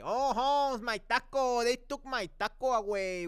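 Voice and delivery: loud, high, hammed-up Mexican accent; forced Mexican accent. Mocking an actor's English in a film, forced Mexican accent. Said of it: "where they forced the Mexican accent".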